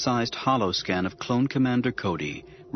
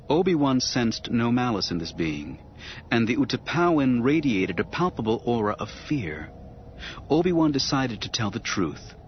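plain reading voice

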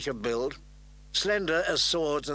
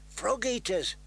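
put-on higher-pitched boy's aside; muttered jibe; 7 semitones higher than usual. A narrator's reading. Little Walder to Big Walder beside him, muttered. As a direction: toss it sideways to a companion. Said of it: "Bran heard Little Walder mutter".

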